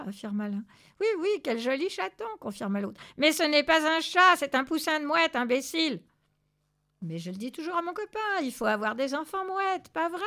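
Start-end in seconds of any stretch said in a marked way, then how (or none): 1.0-2.3 high, quick agreement; hasty flattery. A narrator's reading. Second thug backs the first, hastily flattering. 3.2-6.0 loud, high exasperated outburst; angry correction. A narrator's reading. Zorbas corrects the two thugs, exasperated. 7.0-10.2 lightly voiced high, eager backtrack; placating thug. A narrator's reading. First thug placates Zorbas, lightly acted eagerness.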